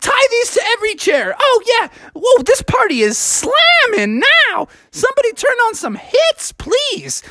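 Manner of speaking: grooving Froggy character; party-happy frog. Acted. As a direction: whoop it up as party host.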